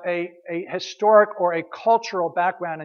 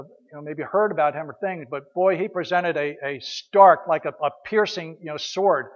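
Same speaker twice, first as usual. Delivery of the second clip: usual speaking voice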